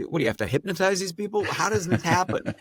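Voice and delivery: high, quick, laughing disbelief; mock bewilderment. Mock amazement at surviving accents.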